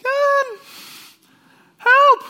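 very high, loud squealing plea; high-pitched squeal. Retold or imitated. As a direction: squeal it like a panicked kid. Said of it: "in a high-pitched voice saying".

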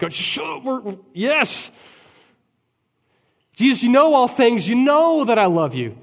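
high, loud, exasperated outburst; Peter, fed up, insisting to Jesus; agitated. Acted. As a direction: snap back, fed up with being asked. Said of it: "Peter gets kinda agitated".

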